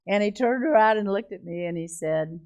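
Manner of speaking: usual speaking voice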